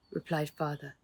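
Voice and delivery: plain reading voice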